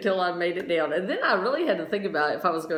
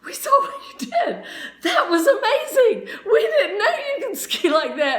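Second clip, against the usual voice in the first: high, loud, laughing praise; amazed classmates; 12 semitones higher, 4 dB louder than usual. Retold or imitated. Classmates praising her wild run, high excited laughing voice.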